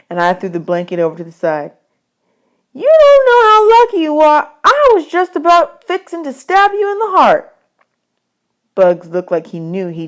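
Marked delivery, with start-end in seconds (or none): 2.7-7.5 put-on high, loud boy voice; Bud, shaken boy. A narrator's reading. Bud warning Bugs after nearly stabbing him, high and loud. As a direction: blurt it out, still shaken.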